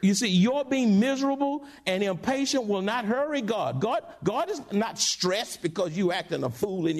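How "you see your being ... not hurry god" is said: normal talking voice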